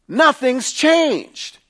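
emphatic high declaration. Real, not acted. Preacher stresses point, then repeats.

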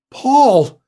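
sharp high call of his name. Acted. Wife trapped in the car calls to her husband, alarmed.

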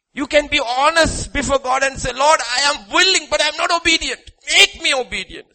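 high, loud and pressing; preaching at full force. Real, not acted. Preacher urging the congregation, high and loud. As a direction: hammer it home to the crowd.

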